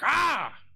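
a loud, high shout of defiance; defiant yell. Retold or imitated. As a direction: yell straight back in God's face. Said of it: "stand in his face and yell back".

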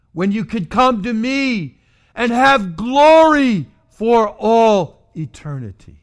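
loud, high pleading cry; Jesus pleading with sinners, loud and high. Acted. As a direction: plead with them like they're drowning. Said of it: "can you hear him pleading with them".

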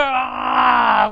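drawn-out mock "Ah" of a furious little one; angry infant scream. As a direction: mimic a child screaming in anger. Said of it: "that little infant scream in anger".

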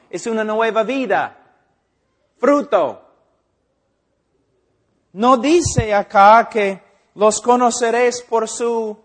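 loud, driving pulpit delivery; forceful preaching. Acted. Preacher thundering at the church about fruit, loud and forceful. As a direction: hammer the point home to the congregation.

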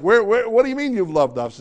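high, loud bewildered asking; mock protest. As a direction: protest like a puzzled crowd. People questioning God.

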